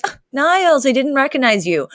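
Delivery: lightly acted feigned recognition; imitated mock surprise. Cece being mean to Niles, imitated mock surprise. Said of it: "And she goes".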